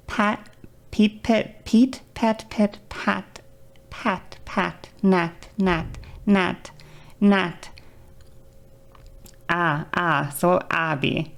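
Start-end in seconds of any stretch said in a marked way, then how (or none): 0.1-10.3 broken-up practice syllables, one at a time; syllable drill. Shown as a demonstration. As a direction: step through each sound separately. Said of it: "What I would do is I would go".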